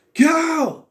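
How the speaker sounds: very high, loud shout of a name. Retold or imitated. Reviewer acting out Eric screaming for Cal, loud and high. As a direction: scream his name across the field.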